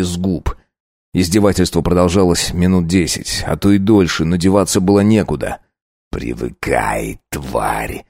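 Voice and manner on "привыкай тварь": low slow contemptuous snarl; hissed through teeth. Acted. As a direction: spit it through clenched teeth. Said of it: "процедил".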